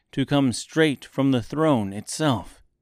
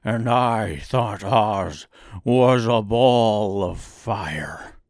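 slow, heavy lion voice; lion exclaiming; 4 dB louder, much slower than usual. A narrator's reading. Lion dismayed at the real Oz, slow lion voice. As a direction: draw it out very slowly as a big lion. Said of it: "exclaimed the lion".

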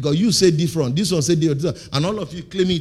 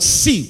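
"see" said louder and higher; stressed see; 5 semitones higher, 5 dB louder than usual. Shown as a demonstration. Preacher repeating "see" for emphasis. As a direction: hit "see" hard. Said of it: "there's an emphasis on that word".

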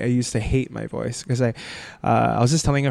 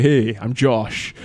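low and slow cool greeting; put-on deep voice; 4 semitones lower, much slower than usual. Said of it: "I wanna be able to be like".

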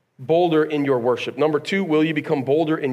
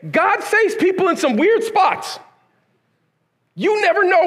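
amazed preaching; 13 semitones higher than usual. Real, not acted.

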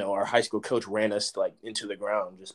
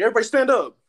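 high, loud eager call; rally the team like a keen teacher's pet; 11 semitones higher, 8 dB louder, faster than usual. Retold or imitated. Freshman showing off to the coach, high loud rallying call. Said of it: "Trying to be like teacher's pet".

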